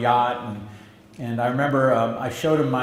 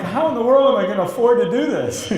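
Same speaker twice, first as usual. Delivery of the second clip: higher, louder words broken by laughter; amused panic, laughing, higher and louder; laughing disbelief; 9 semitones higher, 6 dB louder than usual. Real, not acted. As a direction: laugh out the panic at winning the deal. Laughing disbelief at big deal.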